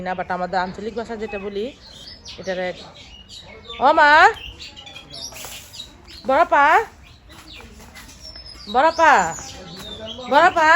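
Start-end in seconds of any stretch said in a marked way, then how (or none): 3.8-10.7 calling out. Real, not acted. Calling out to elder sister.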